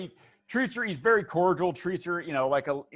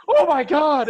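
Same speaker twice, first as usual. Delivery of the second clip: high, loud "oh my God" outburst; big high-pitched exclamation; exclaiming; 12 semitones higher, 12 dB louder than usual. Acted. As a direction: burst out loudly in mock amazement. Retold reaction to the crew's prank.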